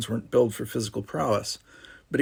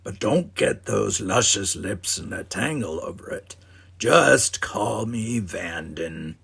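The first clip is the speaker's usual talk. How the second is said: slow, smooth flirtatious drawl; smooth charmer; slower than usual. Acted. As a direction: lay on the charm slowly.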